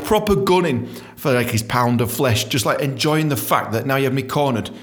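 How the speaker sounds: ordinary delivery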